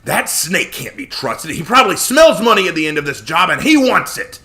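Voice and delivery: loud, high furious tirade; angry rant. Acted. Joshua raging at Connor about the snake. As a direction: rage about the untrustworthy snake.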